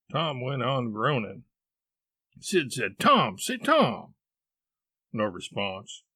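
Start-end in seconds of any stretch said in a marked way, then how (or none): 3.0-4.1 raised, high call for attention; high, louder name-calling. Acted. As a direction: call to rouse your brother. Brother calling groaning Tom.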